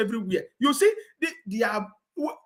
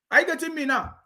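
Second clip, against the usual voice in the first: ordinary talk, nothing marked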